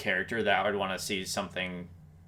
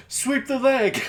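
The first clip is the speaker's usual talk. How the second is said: mock Karate Kid sensei; 13 semitones higher, 4 dB louder, slower than usual. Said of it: "and they're like".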